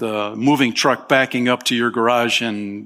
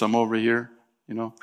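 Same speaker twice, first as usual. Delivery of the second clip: softer, casual little call; mock quiet aside; 6 dB quieter, faster than usual. Preacher mocking a timid beggar, softly. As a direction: say it like a polite memo, barely raised. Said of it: "He doesn't send out a memo".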